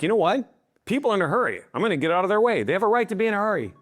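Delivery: high, reasonable voice conceding the point; magnanimous driver. Acted. Virtuous driver making way for hurried people, magnanimous. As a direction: pitch it up, generously making allowance.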